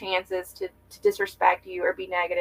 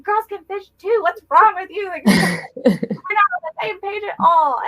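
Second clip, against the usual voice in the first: high loud mock outrage breaking into laughter; reenacting snapping at her husband about girls fishing, high and loud, laughing; laughing exasperated yell; 12 semitones higher, 6 dB louder than usual. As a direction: shout it at him, half laughing, much higher and slower at the end. Retold retort to husband, laughing.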